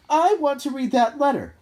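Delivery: put-on high, loud spoilt-child whine; whiny demand. A narrator's reading. Dudley demanding the letter.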